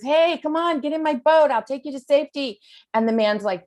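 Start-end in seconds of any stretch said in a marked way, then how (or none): 0.0-2.4 mock call up to a roof, high and louder; hollering from a boat. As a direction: shout up from the rowboat, playful. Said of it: "he hollers up to him and he says".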